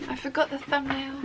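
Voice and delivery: usual speaking voice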